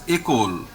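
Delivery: école said "ay-KOL"; French pronunciation. Shown as a demonstration. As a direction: give école a closed first é. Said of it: "the word is pronounced as".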